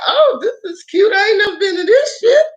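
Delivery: high, squealing delight at the fancy bar; giddy delight. Retold or imitated. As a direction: gush over it, voice cracking high. Excited first sight of fancy bar.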